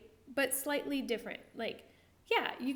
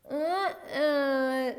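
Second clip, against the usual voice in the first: mock gag; 3 semitones higher, 8 dB louder, much slower than usual. Shown as a demonstration. Mock choking on curled tongue.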